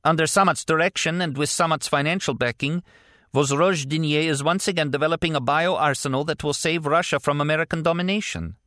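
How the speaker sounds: a mad warlord holding forth, lightly played. A narrator's reading. Warlord's speech continues.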